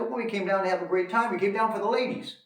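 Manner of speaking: high-pitched, upbeat blurted answer; retold eager confession. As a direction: blurt it out to the cops, pitched right up. Exasperated answer to drilling cops.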